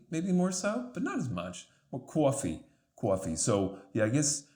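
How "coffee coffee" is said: "coffee" with a rounded "aw", like "cwaw-fee"; New York "coffee". Shown as a demonstration. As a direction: repeat "coffee" with lips rounded on the first vowel. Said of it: "my dad would say coffee".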